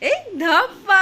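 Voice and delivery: high, loud playful squeal; spring the peekaboo surprise; peekaboo shout. Pretend. Peekaboo reveal to child.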